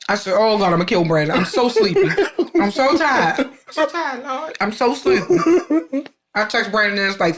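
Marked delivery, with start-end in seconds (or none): 0.4-6.1 high, dragging whine of someone desperate to sleep, slowing to a crawl at the end; hammed-up sleepy groan; mock exhausted whine. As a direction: moan it like you're begging for sleep. Retelling exhausted night after coffee.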